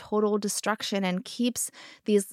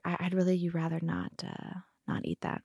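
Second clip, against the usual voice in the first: fading softer until the last words are a whisper; trailing gentle request; 2 semitones lower, 9 dB quieter than usual. Retold or imitated. Mom telling daughter not to eat treats, fading to a whisper. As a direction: let it fade to a whisper. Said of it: "I said to her".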